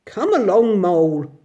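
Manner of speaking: loud, breezy call back over his shoulder; carefree hail to a lagging friend; cheerful. A narrator's reading. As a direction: brush it off cheerily while walking on. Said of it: "replied the Rat cheerfully".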